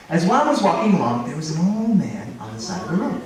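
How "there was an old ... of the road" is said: usual speaking voice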